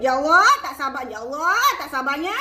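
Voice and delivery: usual speaking voice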